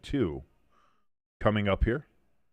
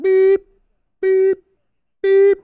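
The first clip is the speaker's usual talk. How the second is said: very high, loud backup-alarm beeps; reversing truck beep; 10 dB louder, much slower than usual. Pretend. Imitating a truck backing up.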